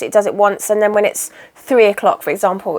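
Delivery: usual speaking voice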